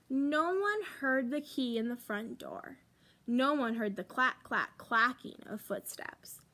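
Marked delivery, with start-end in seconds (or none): none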